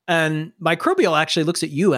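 usual speaking voice

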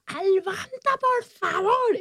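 high-pitched exasperated teacher impression; Goyo. History teacher driven crazy by a pupil, high exasperated plea. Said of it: "mi profesor de historia, Goyo, estaba".

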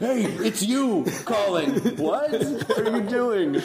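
high, drawn-out mock caller; hammed-up phone greeting. Acting out the prank wake-up call.

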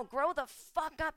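ordinary talk, nothing marked

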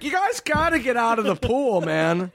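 high, pleading mock guard; old security guard. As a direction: imitate a guard begging trespassers to leave. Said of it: "This old security guard's like".

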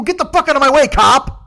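frustrated driver shouting at a cop blocking traffic; angry shout. Retold or imitated. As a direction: blast it like you're stuck behind him. Road rage at cop in traffic.

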